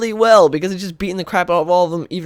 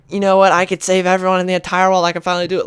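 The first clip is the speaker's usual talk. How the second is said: louder, higher, mildly acted elation; excited retelling; 4 semitones higher, 5 dB louder, faster than usual. Batman answering the Justice League, mock excited. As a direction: burst out with eager resolve, lightly acted. Acting Batman's elated sudden resolve.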